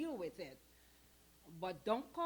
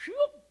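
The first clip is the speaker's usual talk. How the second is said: sudden whoop, much higher and louder; startled whoop; 13 dB louder than usual. Retold or imitated. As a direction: yelp it out in surprise. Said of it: "I went like".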